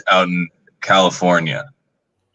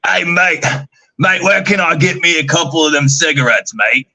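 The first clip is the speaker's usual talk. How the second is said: higher-pitched imitation Australian; Australian accent; 7 semitones higher than usual. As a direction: put on an Australian bloke's accent. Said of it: "he's got this Australian accent".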